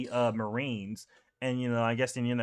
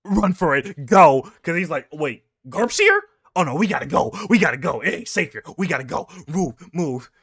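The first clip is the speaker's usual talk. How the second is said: a touch of panicked yelling; pirate captain lightly voiced in panic; panicked flight; 3 semitones higher, 6 dB louder than usual. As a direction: urge the crew to flee fast. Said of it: "he's going".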